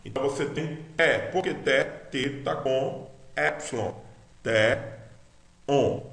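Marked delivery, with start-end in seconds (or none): 1.0-1.2 "T" with a very soft English-like TH. Shown as a demonstration. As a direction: say "T" softly aspirated, tongue light. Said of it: "bem, bem suave".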